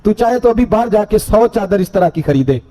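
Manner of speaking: ordinary talk, nothing marked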